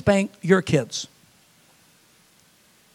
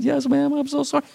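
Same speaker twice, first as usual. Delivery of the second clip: put-on sorry child; 9 semitones higher, 4 dB quieter than usual. Child answering the parent.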